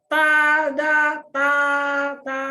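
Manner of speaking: TADA and TA held high and level; loud, long TA on one high pitch, like a surprise TADA; first tone TADA. Shown as a demonstration. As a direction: sustain each syllable of TADA high and even. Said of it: "Oke, nada satu ya".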